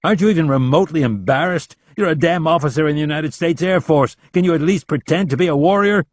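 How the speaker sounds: loud, contemptuous dressing-down; berating. A narrator's reading. Rapp berates Leland, loud and scornful. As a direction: tear into him.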